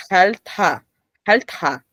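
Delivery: jalta with a breath puff on the T; linked sound. Shown as a demonstration. Teacher showing the linking sound, jalta with aspirated T. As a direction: release the T of jalta into an h. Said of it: "sonido enlace".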